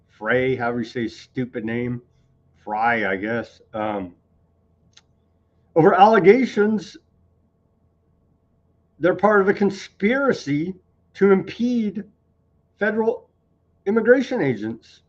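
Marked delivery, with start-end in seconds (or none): 5.8-15.0 mock indignant reading. Mock-alarmed reading of the charges.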